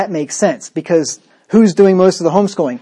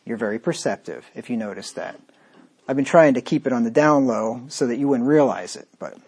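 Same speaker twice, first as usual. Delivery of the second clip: low, softer dry irony; sarcastic; 5 semitones lower, 6 dB quieter than usual. Pretend. Preacher answering listeners who spotted his mockery, drily sarcastic. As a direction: feign praise in an understated aside. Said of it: "I am being very sarcastic".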